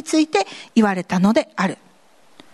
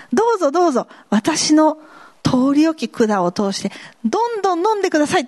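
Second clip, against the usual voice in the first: eager offer; 6 semitones higher, faster than usual. Pretend. A believer inviting others to drink, high and cheery. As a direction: sing out a welcoming go-ahead. Acting willing believer again.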